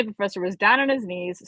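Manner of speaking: ordinary delivery, nothing marked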